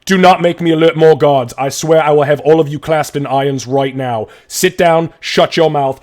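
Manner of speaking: loud, high furious orders; shouting guard. Acted. City guard arrests Gray, furious shouting orders. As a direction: bark arrest orders at him.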